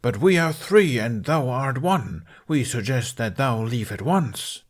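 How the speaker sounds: put-on gruff accuser; slow, stagey gruff warning; gruffer voice. A narrator's reading. Accusers warn the lone knight off, in gruff voice. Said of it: "she yelled in a gruffer voice".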